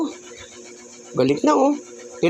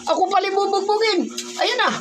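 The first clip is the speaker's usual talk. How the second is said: much higher, whiny hammed-up cries of a beaten victim; mock victim; 12 semitones higher than usual. Uncle playing punching bag for the angry little boy: hammed-up victim cries. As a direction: play the helpless victim begging for help, all in fun.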